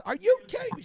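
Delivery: indignant mock outburst; bride going off. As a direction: mimic an upset bride. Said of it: "She's going off".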